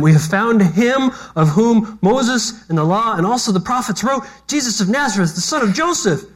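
hammed-up excited announcement; acted excitement. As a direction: read it like Philip bursting with good news. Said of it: "Can you hear the excitement in his voice?".

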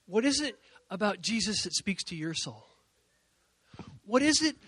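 ordinary talk

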